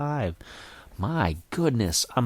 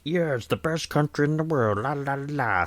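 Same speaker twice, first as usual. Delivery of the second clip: higher-pitched mock flag-waving boast trailing into la-la-la; mock super-patriot; 5 semitones higher than usual. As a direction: parody a blustering super patriot. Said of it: "not gonna make this one of those, like".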